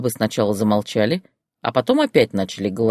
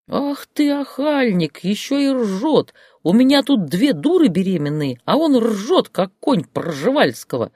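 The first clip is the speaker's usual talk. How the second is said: indignant scolding; 10 semitones higher than usual. Acted. Grandmother scolds laughing Valera.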